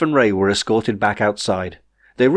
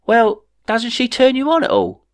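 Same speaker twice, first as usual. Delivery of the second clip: much higher, disbelieving; incredulous question; 13 semitones higher than usual. A narrator's reading. Jeff asks Ray about the Queen, pitched high and incredulous. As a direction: ask it up high, baffled.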